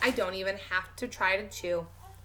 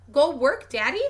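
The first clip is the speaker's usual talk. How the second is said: put-on toddler voice; 5 semitones higher, 8 dB louder than usual. Toddler asks Daddy.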